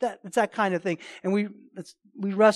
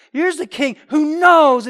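ordinary delivery

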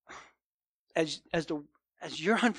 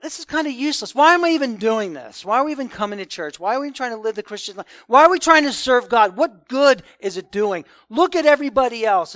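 exasperated venting that swells louder toward the end; frustrated complaint; 3 semitones higher, 8 dB louder than usual. Retold or imitated. Preacher voicing a weary churchgoer, exasperated, growing louder. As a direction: let a worn-out churchgoer vent about why they bother at all. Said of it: "But basically you're saying".

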